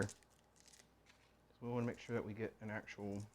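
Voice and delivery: usual speaking voice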